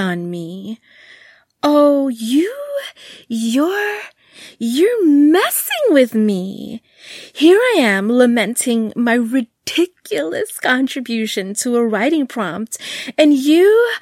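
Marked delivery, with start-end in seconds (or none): 1.6-6.8 higher, louder indignant gasp; surprised realisation. A narrator's reading. 7.3-13.9 louder indignant rant. A narrator's reading.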